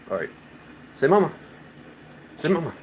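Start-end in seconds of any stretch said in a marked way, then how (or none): 1.0-1.3 firm command. Real, not acted. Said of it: "Come on, you can do it better".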